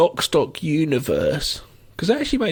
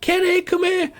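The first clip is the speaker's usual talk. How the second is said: shrill high-pitched Scouse impression, much higher; high Scouse mimicry; 14 semitones higher than usual. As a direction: squeak out the name like the Liverpool crook. Mimicking the Liverpool guys.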